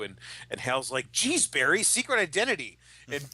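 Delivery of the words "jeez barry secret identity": high, loud mock exasperation; exasperated scolding. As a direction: act out a friend scolding a blabbermouth. Exasperated Hal scolds Barry in retold scene.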